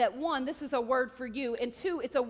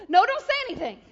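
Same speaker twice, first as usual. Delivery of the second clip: loud, high mock-scolding interruption; exasperated command; 9 semitones higher, 13 dB louder, faster than usual. God stopping the speaker's chatter, shouted reprimand. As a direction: jump in with a raised, exasperated shout.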